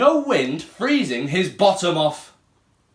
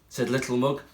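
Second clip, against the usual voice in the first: usual speaking voice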